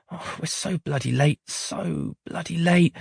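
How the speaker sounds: soft, drawn-out despairing mum; the mum groaning to herself about being late; a hint of despair. A narrator's reading.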